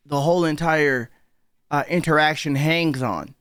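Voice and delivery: usual speaking voice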